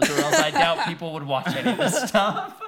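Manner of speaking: real laugh taking over the words. Real, not acted. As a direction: crack up partway through the sentence. Laughing at their own weirdness.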